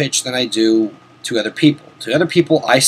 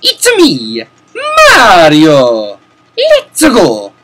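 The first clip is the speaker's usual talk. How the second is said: high loud cheerful Italian plumber voice; Mario bursting with energy, loud and high; 4 semitones higher, 6 dB louder, much slower than usual. Retold or imitated. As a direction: shout it like Mario jumping in. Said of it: "my best Mario impression".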